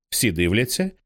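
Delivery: ordinary delivery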